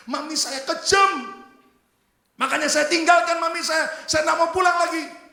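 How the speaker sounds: loud, high, acted indignation; angry daughter's outburst. Daughter telling soup seller of her cruel mother, angry outburst. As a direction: burst out shouting against your mother.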